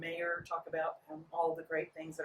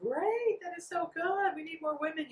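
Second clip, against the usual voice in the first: high, louder gushing voter; gushing enthusiasm; 11 semitones higher, 4 dB louder than usual. Retold or imitated. Voter greeting a woman candidate, gushing enthusiastically. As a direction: play a delighted supporter, pitched way up.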